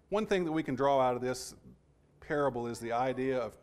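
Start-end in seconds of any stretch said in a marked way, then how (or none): none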